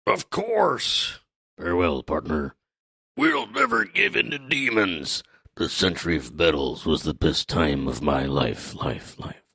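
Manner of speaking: Dory and Brogy. Acted. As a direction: play a theatrical giant, fading out in a mock echo. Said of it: "Dory and Brogy say".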